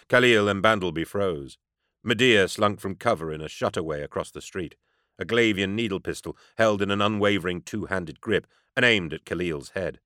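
usual speaking voice

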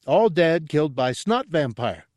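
high, loud character announcement; triumphant. A narrator's reading. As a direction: reveal the culprit proudly in a high voice. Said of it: "Gartog smiled triumphantly".

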